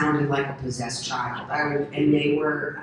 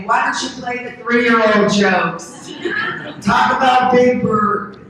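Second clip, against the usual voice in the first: louder, slower, high teasing jab; mock heckle; 7 semitones higher, 9 dB louder, slower than usual. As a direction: heckle a comic who bombed. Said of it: "You can say".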